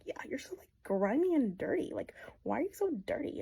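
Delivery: high, breathy sneer of a snob; mocking. Snobby Kathy teasing Heathcliff, high mock sneer. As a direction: look down your nose at him.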